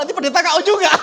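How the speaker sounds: loud, high, through laughter; laughing. Real, not acted. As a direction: crack up at your own misfortune. Jokes about his own defeat.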